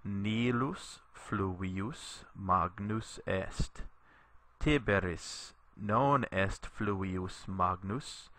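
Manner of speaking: slow, careful Latin read for students to repeat; slow model reading. Shown as a demonstration. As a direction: read it very slowly so the class can follow. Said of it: "Read with me".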